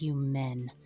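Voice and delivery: slow, seething in-character scolding. Acted. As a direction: draw out the contempt through a simmering anger. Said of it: "she's just seething".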